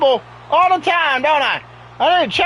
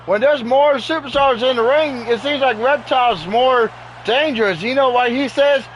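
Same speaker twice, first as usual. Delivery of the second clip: usual speaking voice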